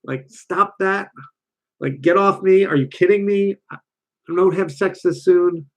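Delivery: high, sharp, slow outraged snapping; harsh delivery. Retold or imitated. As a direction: push him away hard and shrill. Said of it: "So if you deliver it like".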